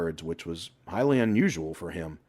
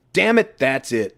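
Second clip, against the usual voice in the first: loud, slow angry yell; furious slow shout at his friend; scream; 6 semitones higher, 8 dB louder than usual. A narrator's reading. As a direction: bellow it in fury. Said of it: "Toby screamed".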